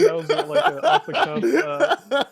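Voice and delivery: high, loud, laughing words. Real, not acted. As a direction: talk through real laughter. Player laughs at own line.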